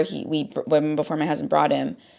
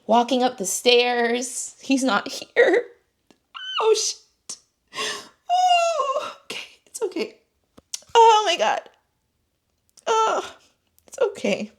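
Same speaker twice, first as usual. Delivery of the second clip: real tears, high voice breaking into sobs; crying; 8 semitones higher than usual. Real, not acted. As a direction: try to steady yourself while crying. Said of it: "not because I'm crying".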